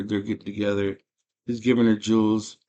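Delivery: ordinary delivery, nothing marked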